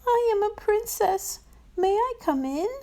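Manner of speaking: high, sweet princess voice; a young princess asking to come in. A narrator's reading. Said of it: "she asked".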